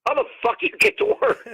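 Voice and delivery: second take, way up high; squeaky incredulous repeat. Retold or imitated. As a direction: repeat it, voice cracking up in mock outrage. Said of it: "I go".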